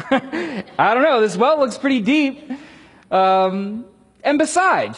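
high, loud, slow with a laugh; laughing skeptic. Retold or imitated. Samaritan woman teasing Jesus about having no bucket, laughing. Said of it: "And she's like".

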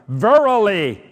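"verily" proclaimed loud and high; "verily" as a forceful pronouncement; emphatic. Retold or imitated. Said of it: "that is a very strong word".

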